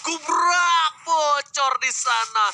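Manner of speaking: esports caster. Acted. Hyped play-by-play call.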